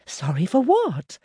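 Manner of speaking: lightly voiced wife, worried; Margaret, farmer's wife. A narrator's reading. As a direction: ask her husband with dawning dread. Margaret asks.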